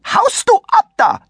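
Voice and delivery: outraged lady's shriek; shrieking yell. Acted. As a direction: scream at the kid from above. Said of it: "schrie es gleichzeitig von oben".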